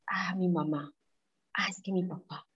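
breathy whisper of the parents' voices in her head; whispered inner voices. Retold or imitated. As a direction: murmur them like nagging thoughts.

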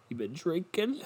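put-on old neighbor voice. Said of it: "that's an old man that lived across the street".

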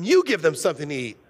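quick, curt reply to the disciples; snappy retort. Retold or imitated. Jesus answering the disciples, quick and curt. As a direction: toss it back fast and short. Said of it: "So they're snapping back at each other".

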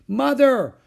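high, loud cry to Mother; tattling child's yell. A narrator's reading. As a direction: call out in outraged alarm. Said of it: "cried Beezus".

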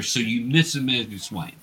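usual speaking voice

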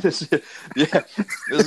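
laughing through the words; giggling, amused. Real, not acted. Laughing at the professional review joke.

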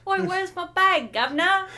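loud, high mock Cockney demand; better Cockney accent. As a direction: bark it like a London tough. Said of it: "I feel like that's probably what it was more like".

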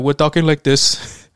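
lightly mocked African accent, slow; exaggerated African accent, drawn out; overly African accent. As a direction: parody a put-on African accent, slowly. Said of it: "overly African".

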